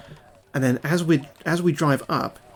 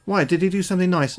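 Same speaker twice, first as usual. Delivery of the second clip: higher, innocent questioning voice; imitating young daughter; 6 semitones higher than usual. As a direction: ask sweetly like a little girl.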